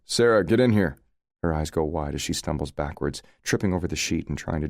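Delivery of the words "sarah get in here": louder, calling down the hall. A narrator's reading. Leo calling Sarah from the security room, raised voice. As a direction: call her in from the next room. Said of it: "call out the door".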